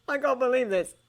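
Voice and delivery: ordinary delivery, nothing marked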